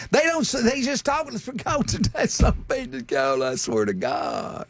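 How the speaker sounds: mock slurred Southern rambling. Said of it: "they were completely unintelligible".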